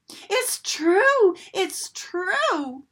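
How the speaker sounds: high, loud happy yell; excited yell. A narrator's reading. New duckling realising the truth, high excited yell. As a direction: shout it with joy. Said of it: "he yelled".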